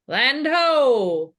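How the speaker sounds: sailor's call. Acted. Sailor joke cry.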